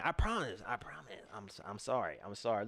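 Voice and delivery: breathy whisper; whispered promise. Real, not acted. Promising fans he'll get to the game, whispered. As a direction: whisper it like a secret.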